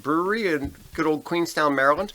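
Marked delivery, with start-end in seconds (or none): none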